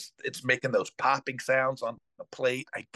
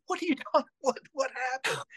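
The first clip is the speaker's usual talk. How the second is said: high, laughing disbelief; incredulous; 12 semitones higher than usual. Retold or imitated. Father hearing the nanny's news, high with a laugh. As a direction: squeak out the question in disbelief.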